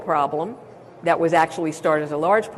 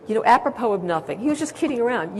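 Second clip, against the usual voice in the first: normal talking voice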